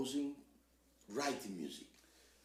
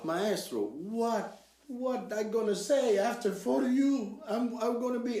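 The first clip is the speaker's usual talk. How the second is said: rising, louder, urgent pleading; nervous plea; 8 semitones higher, 5 dB louder than usual. Retold or imitated. Young trumpeter pleading with the maestro, high and urgent. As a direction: beg the maestro, voice climbing high.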